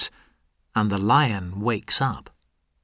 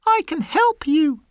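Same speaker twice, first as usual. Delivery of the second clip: very high tiny mouse voice; very high mouse giving orders; brave little mouse. Acted. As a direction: pitch way up for a small determined mouse. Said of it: "says the mouse".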